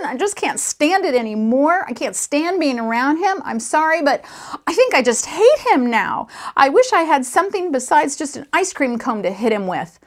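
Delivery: acted boy at the end of his rope; exasperated outburst. A narrator's reading. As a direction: pour out the grievance, breathless with temper. Boy pours out his grievance.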